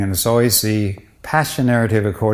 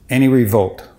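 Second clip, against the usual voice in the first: usual speaking voice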